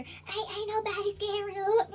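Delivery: high, slurred tipsy spirit; drunk uncle voice. Retold or imitated. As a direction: play a drunk relative bragging.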